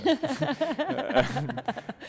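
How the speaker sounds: a bright, high burst of laughter; real laugh, pitched high. Real, not acted. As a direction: laugh out loud at the family joke. Laughs at "all mistakes" joke.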